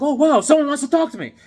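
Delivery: high, fast, loud excitement; giddy delight. Acted. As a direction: burst out, thrilled anyone noticed. Ignored Tom Thumb gets attention.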